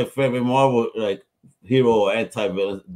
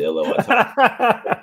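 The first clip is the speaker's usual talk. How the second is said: high, slow sing-song warning; mock neighbour shout; 10 semitones higher, 3 dB louder, much slower than usual. Host teasing loud Puerto Rican neighbours, high drawn-out mock warning. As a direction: draw it out high like a neighbour yelling out the window.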